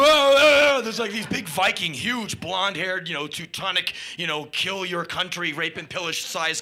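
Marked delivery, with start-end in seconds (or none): 0.0-0.8 loud high strained yell; hammed-up amp scream; mock straining cry. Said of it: "And the amp's like".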